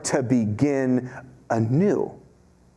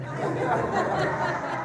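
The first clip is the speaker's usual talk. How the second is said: high laugh; 4 dB quieter than usual. Real, not acted. Laughing at own family.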